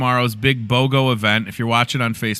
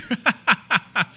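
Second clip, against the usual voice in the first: high, loud burst of laughter; real amused cackle; 12 semitones higher, 3 dB louder than usual. Real, not acted. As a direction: laugh out in a string of ha-has. Laughs at wrong picture sent.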